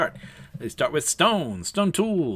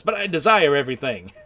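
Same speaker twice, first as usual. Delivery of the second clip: greedy demand; 3 semitones higher, 7 dB louder than usual. Acted. Greedy character's big demand.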